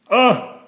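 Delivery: a sharp high ugh of disgust. A narrator's reading. Fussy man recoils at bits in marmalade, sharp disgusted ugh. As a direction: recoil from the jam. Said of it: "he exclaimed".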